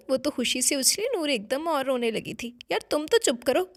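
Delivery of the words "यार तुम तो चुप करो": a sharp, louder rebuke; scolding. Acted. Young woman brushing off a crying little girl, scolding. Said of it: "उसे झिड़क दिया".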